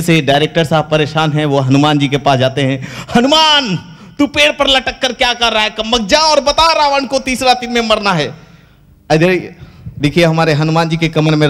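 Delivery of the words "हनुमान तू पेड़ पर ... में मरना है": high, scolding shout; exasperated yell. Acted. Fed-up director yelling at Hanuman hanging in the tree. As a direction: snap at the actor up the tree.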